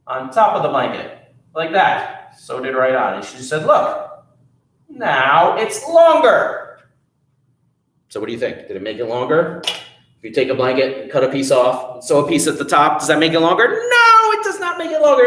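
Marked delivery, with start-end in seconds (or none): 3.7-6.8 high and drawn out, pleased with herself; high, slow proud. Acted. Wife proudly showing the blanket to Jack, high and slow. 13.7-15.3 high, loud emphatic denial. A narrator's reading. Storyteller answering her young listeners, high and emphatic.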